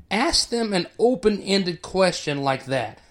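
ordinary talk, nothing marked

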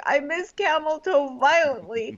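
high, mock tearful wail; Annalise. Annalise crying on the date, hammed-up weepy. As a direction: whine it as if crying. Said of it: "Yeah, she's crying".

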